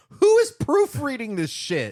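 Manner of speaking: indignant cry at sloppy editing; exasperated outburst. Retold or imitated. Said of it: "and go".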